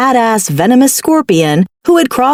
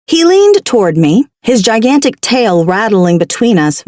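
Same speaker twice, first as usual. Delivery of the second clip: ordinary delivery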